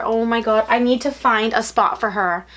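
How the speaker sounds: normal talking voice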